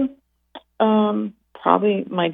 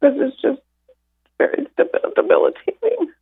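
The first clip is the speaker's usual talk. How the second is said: teary; 10 semitones higher than usual. Real, not acted. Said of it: "sorry if I get teary".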